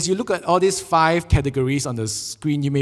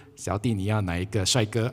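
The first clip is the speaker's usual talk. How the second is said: auntie; 6 semitones lower, 4 dB quieter than usual. Pretend. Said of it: "the auntie is there smiling at you".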